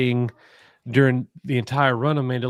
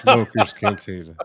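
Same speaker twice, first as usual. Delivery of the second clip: imitating the friend; 4 semitones lower, 3 dB louder than usual. Repeating co-host's slip as a joke, low laughing imitation. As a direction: lower put-on voice with laughter. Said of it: "she talks like this".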